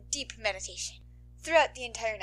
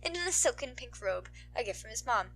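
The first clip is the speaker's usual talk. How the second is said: plain reading voice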